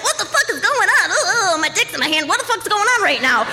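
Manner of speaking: hammed-up flustered panic; wide-eyed panic. Imitating naked boyfriend caught off guard, mock wide-eyed panic. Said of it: "wide-eyed, like".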